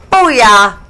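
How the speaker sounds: loud gloating booyah; triumphant whoop. Retold or imitated. To her husband after docking the boat, loud gloating booyah. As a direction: crow it at the husband. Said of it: "and I went".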